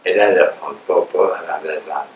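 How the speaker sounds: usual speaking voice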